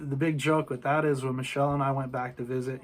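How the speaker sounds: usual speaking voice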